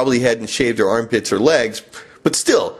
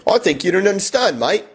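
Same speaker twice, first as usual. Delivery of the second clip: put-on high-pitched broad Australian accent; Aussie accent; 6 semitones higher than usual. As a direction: play a woman with a thick Aussie twang. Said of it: "or an Aussie accent".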